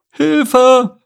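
very high, loud squeal for help; tiny piping voice crying out; squeaky ants. Acted. Ants spot the beaver and cry out, squeaky. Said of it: "erklang ein Chor piepsiger Stimmen".

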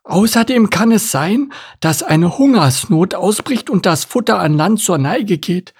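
high-voiced, careful turtle character; high turtle voice, agreeing and advising; cautious turtle. Voices turtle listing dangers.

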